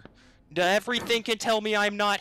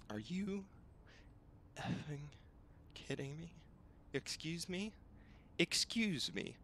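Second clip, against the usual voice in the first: hushed disbelief; 14 dB quieter than usual. Real, not acted. Exasperated at the game.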